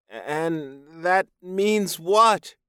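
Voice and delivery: slow, high, loud question; nervous captive. A narrator's reading. Captive man asking his buyer, nervous and high.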